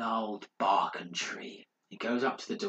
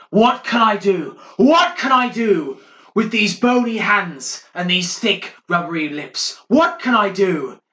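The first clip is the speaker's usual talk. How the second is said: a much higher, much louder wailing cry; mad monk shouting; 9 semitones higher, 12 dB louder than usual. Acted. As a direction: howl it through the walls like a madman. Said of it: "Morris starts shouting through the walls".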